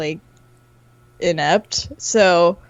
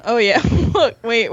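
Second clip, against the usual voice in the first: ordinary talk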